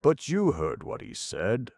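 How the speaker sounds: lowing ox. Acted. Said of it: "lowed the ox".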